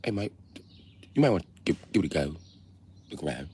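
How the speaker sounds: soft, hushed British warning; a nervous Brit murmuring to his mate; quiet mock British accent. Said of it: "the other British guy was like".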